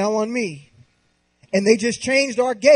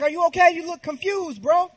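put-on higher, coaxing voice for the baby; higher, playful voice to a baby son; higher baby talk; 7 semitones higher than usual. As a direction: sweet-talk the baby, pitched up. Dad talking to his baby.

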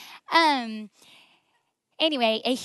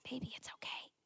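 soft unvoiced whisper; whispered comfort; 17 dB quieter, faster than usual. Husband comforting his crying wife, in a soft whisper. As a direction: hush it like a husband soothing. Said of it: "he is just like".

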